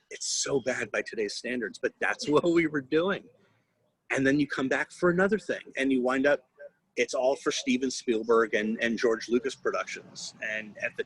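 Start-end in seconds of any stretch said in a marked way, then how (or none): none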